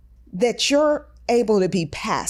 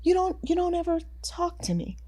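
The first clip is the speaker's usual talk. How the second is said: small, high, half-whispered plea; hurt soft complaint; 7 semitones higher, 8 dB quieter, faster than usual. Retold or imitated. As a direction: imitate a neglected girlfriend, softly and high. Voicing a girlfriend's complaint.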